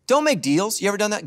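ordinary talk, nothing marked

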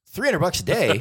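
stunned at the money; amazed echo. Retold or imitated. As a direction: repeat the sum in astonishment. Danny amazed at offer.

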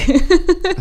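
a short real laugh. Real, not acted. Asking how much he paid, a short laugh. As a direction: laugh at the question. Listener laughs at payment question.

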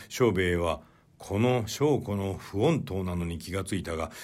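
ordinary delivery, nothing marked

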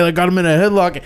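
normal talking voice

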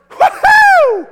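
very loud, high "woohoo" whoop; full-voiced celebratory holler, way up high; freaking-out cheer. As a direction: let out a sports fan's victory whoop. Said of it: "we were, like, freaking out".

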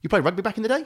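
hammed-up stranger's nosy question; imitated Kiwi waiter. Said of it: "And he goes".

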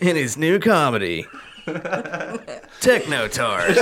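high, drawn-out comedy trailer announcer; happy rom-com trailer voice. Shown as a demonstration. Co-host announces the new comedy, happy trailer voice. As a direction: sell the silly title like a rom-com trailer. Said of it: "rom-com trailer voice".

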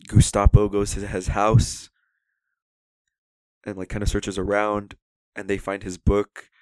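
ordinary talk, nothing marked

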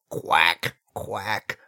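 deep, loud quacking duck; low loud duck quack. Acted. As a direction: give a big low quack. Duck sounds in skit.

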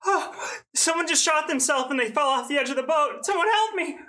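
hammed-up panic to fool the crowd; fake panicked scream. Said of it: "I scream".

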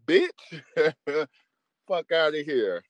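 normal talking voice

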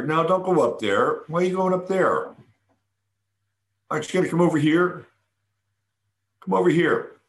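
high sing-song calling to a pet; high coaxing pet voice; high cooing call to the cat. Retold or imitated. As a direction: coax the cat over in a high voice. Calling cat to come over.

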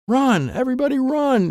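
high urgent shout; yell. Acted. Izzy yells at everyone to run. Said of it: "Izzy yells".

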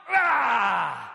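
long falling scream; loud yell. Acted. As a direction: scream out a wordless cry. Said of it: "he shouted all the more".

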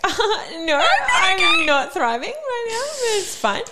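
high, slow, laughing false cheer; laughing mock reply. Friend mocking her breakup reply, high and laughing. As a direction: squeak it out through laughter. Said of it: "You're like".

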